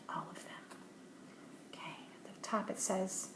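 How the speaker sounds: soft unvoiced whisper. Real, not acted. Parent about to sound out a word for a child, whispering. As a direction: murmur it under the breath.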